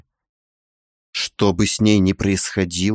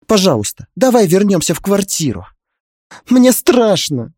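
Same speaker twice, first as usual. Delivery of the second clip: higher, louder, sniffling plea that slows at the end; pitiful frightened plea; 10 semitones higher, 3 dB louder than usual. A narrator's reading. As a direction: beg him tearfully, frightened. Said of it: "Шмыгнула она носом и сделала это настолько жалобно". (жалобно, испуганно, со всхлипом)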